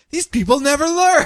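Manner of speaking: high, weary sigh of disbelief; exasperated villain. Retold or imitated. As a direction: roll your eyes at fools. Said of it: "looking at each other like".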